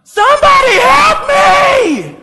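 very high, loud cry for help; panicked scream. Pretend. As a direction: scream it as if chased by a murderer, drawn out. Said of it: "you'd be like".

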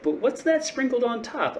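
high puzzled question; surprised question. Retold or imitated. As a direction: ask it, suddenly surprised. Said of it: "But then it's like".

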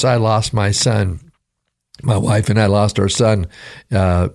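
ordinary delivery

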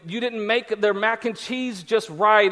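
usual speaking voice

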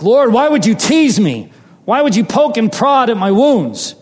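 wounded, heated questioning of the Lord; retold heated complaint. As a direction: act out an indignant prayer. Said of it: "I was so heated".

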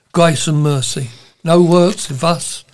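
normal talking voice

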